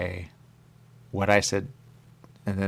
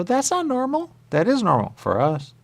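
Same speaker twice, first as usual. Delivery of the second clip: high, loud outraged protest; indignant shout; 5 dB louder than usual. Acted. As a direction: cry out, offended. Acted indignant quote.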